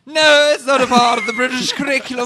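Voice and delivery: loud, high hammed-up British snob; mock British. Teasing the friend about British schooling, hammed-up British accent. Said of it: "Versus, like".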